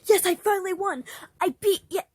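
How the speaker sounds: loud, high victory cheer; excited cheer. Acted. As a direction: shout it out in triumph.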